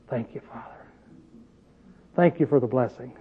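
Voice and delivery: soft, low, prayerful gratitude; humble thanks. Acted. Thanking Father when slandered, soft and humble. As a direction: quietly give thanks, voice dropped low.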